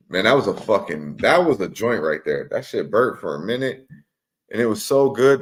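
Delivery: ordinary talk, nothing marked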